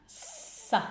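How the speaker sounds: "Sa" softly, with a very long hissed s; stretched first sound. Shown as a demonstration. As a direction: draw out the s of "Sa" as a clue. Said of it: "Qu'est-ce que vous entendez en tout premier dans sa?". (« Sa » doux, s initial très allongé)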